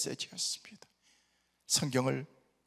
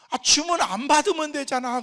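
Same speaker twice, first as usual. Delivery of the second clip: daughter's quip; 11 semitones higher, 12 dB louder than usual. Retold or imitated.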